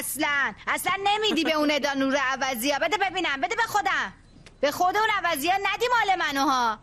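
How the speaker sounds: high, fast indignant outburst; snatch it back, outraged; indignant protest. Acted. Mother forbids giving gift.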